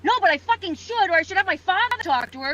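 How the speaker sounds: furious outburst; shaking with anger. Real, not acted. Furious reply about mother.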